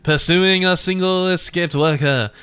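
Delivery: hammed-up villainous French accent. Latour dismissing the escaped worker in a villain French accent. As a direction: play a scheming French villain, a bit over the top. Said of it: "getting into more villain French".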